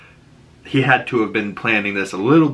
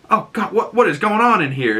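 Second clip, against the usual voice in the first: storming in; 10 semitones higher than usual. Retold or imitated. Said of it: "storms in and he goes".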